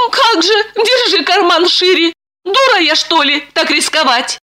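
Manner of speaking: high-pitched sarcastic heroine; high, indignant inner retort; scornful thought. A narrator's reading. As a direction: scoff privately in a high voice.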